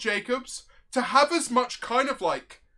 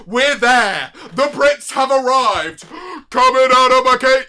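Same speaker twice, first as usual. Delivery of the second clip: hammed-up excitement; 3 semitones higher, 9 dB louder than usual. Gasping, hammed-up entrance.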